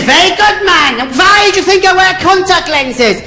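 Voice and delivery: very high, mock-approving interviewer; Nicky Lauder. Airline boss questioning the third applicant, mock approving. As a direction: quiz him in a high, comic boss voice. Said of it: "And Nicky Lauder said".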